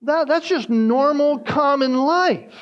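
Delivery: fervent sermon delivery; preaching. Acted. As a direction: preach it out to the congregation with rising force. Preaching at full force.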